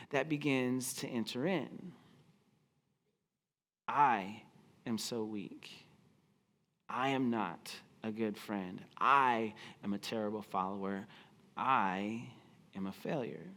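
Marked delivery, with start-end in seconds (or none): 3.9-13.5 low, soft self-reproach with long pauses; a broken man condemning himself quietly; ashamed inner voice. Acted. Peter's shame-filled inner voice.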